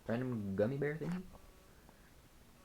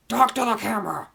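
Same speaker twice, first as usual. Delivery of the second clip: high, very loud yell; shouted command; 11 semitones higher, 12 dB louder than usual. Pretend. Vlogger yelling at the silent cat, high shouted command. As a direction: yell the order at the top of your voice.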